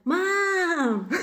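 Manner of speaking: put-on high, whiny kid's call of Mom; child calling out. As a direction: call for mom like an impatient toddler. Child tugging at parent's face.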